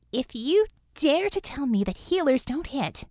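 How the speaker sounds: lightly voiced warning to a teammate. A narrator's reading. Bella warns Pride, mild warning. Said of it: "she warned".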